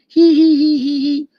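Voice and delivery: high squeaky mocking giggle; tiny critter tittering at the hunter; squirrel giggle. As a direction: cackle like a smug little squirrel. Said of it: "all them squirrels up there going".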